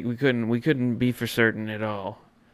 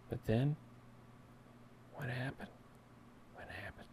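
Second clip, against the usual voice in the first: much softer, dropping to a whisper; hushed suspense; 17 dB quieter than usual. Acted. Host to fans, hushed suspense before the big play. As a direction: lean in and hush before the reveal.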